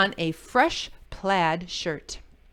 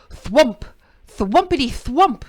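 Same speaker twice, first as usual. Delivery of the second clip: punchy, loud knocking noises; loud thumping sound; 4 semitones higher, 10 dB louder than usual. A narrator's reading. Beaver pounding Jim's door, loud thumps.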